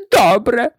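high-pitched curt cry; shouting queen. A narrator's reading. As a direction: call out approval imperiously. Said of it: "крикнула королева".

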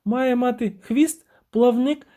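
high-voiced siren, reproachful; indignant siren. Siren scolds her lover for sacrificing nothing, high reproach. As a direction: complain in a high, lilting voice.

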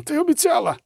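very high, desperate cry; indistinct cry. A narrator's reading. Fallen soldier pleading with Райла, high cry. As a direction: cry out a plea in a strained high pitch. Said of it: "крикнув невиразно".

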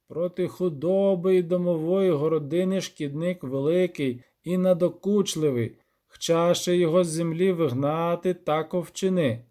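lightly sing-song granny reader; old granny reading aloud. Acted. Granny reads the old book aloud.

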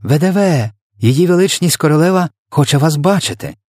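summoning call, pitched well up. A narrator's reading. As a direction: call out to someone in the garden. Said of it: "повернула голову до саду і покликала".

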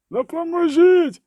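very high, drawn-out cry for help; stretched, high-pitched distress call; shouted plea. A narrator's reading. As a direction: yell desperately for help. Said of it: "крикнув перевізник".

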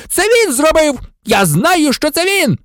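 high, frantic accusation; enraged screech. A narrator's reading. Snape insisting Potter is guilty, screeching. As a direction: screech it with obsessive certainty. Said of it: "верещав Снейп".

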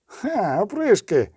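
a touch of a jangling, rattly shout; rattling bark. A narrator's reading. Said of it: "грикнув деренчливо один із рицарів".

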